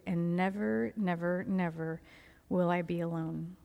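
soft, measured, heartfelt reading; reading a journal prayer. Acted. Woman reads her journal prayer to God before surgery, soft prayerful reading. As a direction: read it softly and steadily, as a private prayer.

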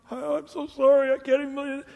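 mock weeping. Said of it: "people weeping and crying".

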